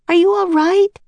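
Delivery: high, loud anxious question; crying out. A narrator's reading. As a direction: cry out to the fallen man. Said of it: "cried Annie".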